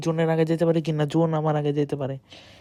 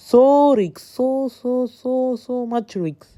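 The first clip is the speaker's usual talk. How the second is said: playful sing-song; 6 semitones higher, much slower than usual. Real, not acted. Gamer joking to his viewers about the risk, high and playful. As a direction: tease the risk in a lilting high voice.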